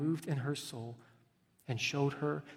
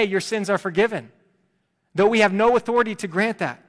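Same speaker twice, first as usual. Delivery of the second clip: loud, higher fervent preaching; 6 semitones higher, 13 dB louder than usual. Acted. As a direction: drive the challenge home. Preaching at full force.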